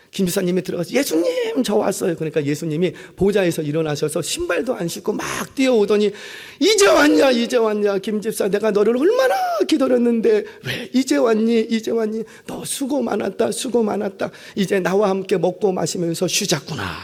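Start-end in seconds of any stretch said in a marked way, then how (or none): 0.9-2.2 high-pitched, bright call on 예수님; eager arrival. Retold or imitated. Humble deacon greeting Jesus in heaven, eager. 6.6-16.9 joyful welcome. Retold or imitated. Jesus running to welcome the faithful deacon, overjoyed.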